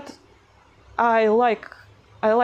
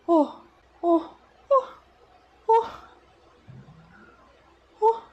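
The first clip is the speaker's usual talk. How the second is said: very high, repeated practice takes; higher voice; 10 semitones higher than usual. Shown as a demonstration. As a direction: pitch the "oh" way up, put on. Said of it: "say it in a higher voice".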